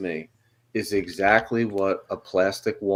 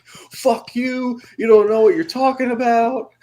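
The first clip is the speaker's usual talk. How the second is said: high-pitched mock rant, louder toward the end; imitated angry outburst; 12 semitones higher, 5 dB louder than usual. Retelling to friends how he blew up over food criticism, mock furious. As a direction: act out someone getting really mad. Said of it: "He got so mad".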